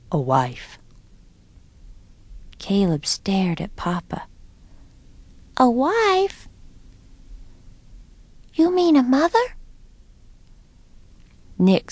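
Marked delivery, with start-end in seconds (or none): 0.1-0.8 low-pitched father, falling statement; father's statement. A narrator's reading. Papa tells his children his plan, low statement. 5.6-6.5 put-on high-pitched child, astonished and rising; astonished question. A narrator's reading. Caleb stares at Papa on hearing news, astonished. 8.5-9.5 put-on high, wondering child's voice; amazed question. A narrator's reading. Caleb asks Papa about a new mother, amazed.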